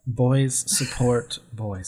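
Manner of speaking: drawn out through a laugh; slow laughing repeat. Pretend. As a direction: read it slowly while laughing. Repeating the note slowly, laughing.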